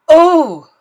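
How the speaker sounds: loud, high anguished oh; dismayed cry. Acted. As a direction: cry out in dread. Bell ringer wrings his hands.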